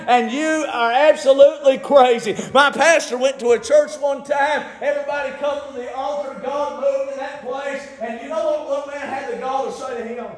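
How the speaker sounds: high, urgent pulpit delivery; excited revival storytelling; full-force preaching. Acted.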